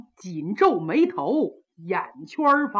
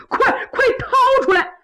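much higher, louder panicked cry; frantic order; 5 dB louder than usual. Acted. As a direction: yell in a panic to spit it out. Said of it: "可把八王急坏了".

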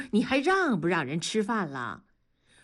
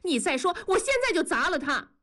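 higher, sharp threatening wife, a bit faster; loud threat; 10 semitones higher, faster than usual. A narrator's reading. Wife holding up the glass jars, threatening loudly. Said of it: "大声地说".

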